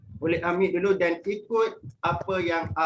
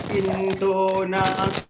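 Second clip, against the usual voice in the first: slow, loud and drawn out; level two intonation; 4 dB louder, much slower than usual. Shown as a demonstration. Teacher modelling level two for pupils, slow and loud. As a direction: stretch it like a call. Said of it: "kita masuk pula level two".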